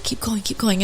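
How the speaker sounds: quietly coaching from the side; hushed cop prompt. Retold or imitated. Cop prompting during call.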